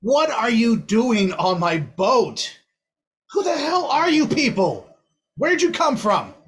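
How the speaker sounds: angry chiefess. Acted. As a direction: bark out the questions. Chiefess questions strangers.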